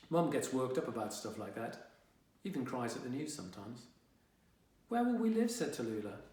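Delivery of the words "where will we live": put-on high, louder child voice; squeaky kid, alarmed; little sister's worried question. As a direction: ask it like an anxious little girl.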